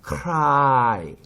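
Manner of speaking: "cry" drawn out loud and high; loud, slow model of "cry"; syllable demonstration. Shown as a demonstration. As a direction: stretch "cry" as a separate syllable. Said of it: "요게 붙었죠".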